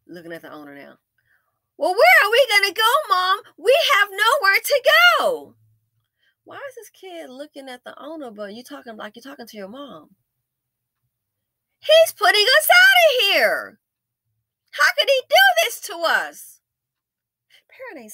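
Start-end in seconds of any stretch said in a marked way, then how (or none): 1.8-5.5 imitated child. Kid asks mom where to go, high mock wail. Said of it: "and says". 11.8-16.6 very high, loud, slow mock wail; hammed-up child's staged outcry; imitated little girl. Little girl complains of the owner, high staged wail. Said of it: "like, a little girl to go out there and say that".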